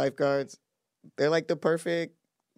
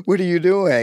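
shocked gasp; 8 semitones higher than usual. Retold or imitated. Gasp then voiced quote.

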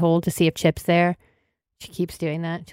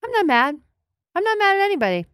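high strained denial; 13 semitones higher, faster than usual. Acted. Bride insisting she's not mad.

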